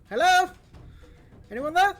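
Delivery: high, loud hail; calling out in Infernal. Acted. Robin hailing in Infernal through bars, loud and high. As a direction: shout it into the dark. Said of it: "Then, then to Infernal".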